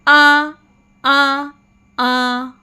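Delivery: "आ" held long and level, one at a time; zabar vowel drill. Shown as a demonstration. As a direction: lead learners through a sustained "आ". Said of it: "मेरे साथ मिलके पढ़िए". ("आ" को लंबा खींचकर दोहराएँ)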